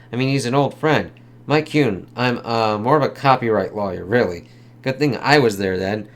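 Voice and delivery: a hint of a tough detective; reading comic dialogue. Reads the release scene.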